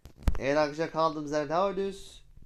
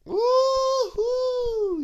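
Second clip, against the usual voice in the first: long high joyful yell, much louder; excited whoop; 7 dB louder than usual. Real, not acted. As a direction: let out a big thrilled whoop. Escaping police. (coşkulu, yüksek sesli bir sevinç çığlığı)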